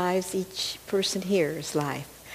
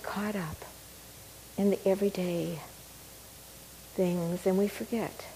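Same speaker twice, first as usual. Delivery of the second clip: guided meditation; 5 dB quieter than usual. Acted. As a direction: ease them gently into calm.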